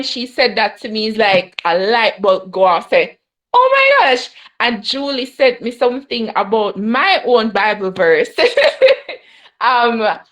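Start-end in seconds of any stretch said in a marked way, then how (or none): 3.5-4.3 high, loud "oh my gosh"; sudden realization cry; surprised gasp. Retold or imitated. 8.4-9.2 high laugh. Real, not acted. Laughing at her own verse.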